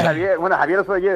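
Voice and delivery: ordinary talk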